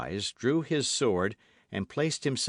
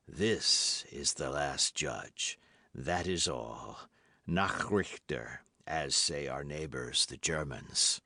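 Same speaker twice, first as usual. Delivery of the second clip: grim executioner; 7 semitones lower, 4 dB quieter, slower than usual. A narrator's reading.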